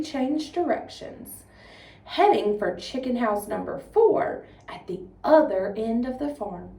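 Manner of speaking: lively, expressive storytelling voice; dramatic story reading. Shown as a demonstration. 4-H agent demonstrating a dramatic reading for students, expressive storytelling. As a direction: read it out like a performance for kids.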